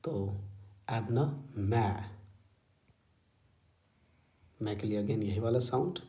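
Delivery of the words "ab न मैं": slow syllables. Shown as a demonstration. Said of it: "आवाज़ में एक हाइक आएगा".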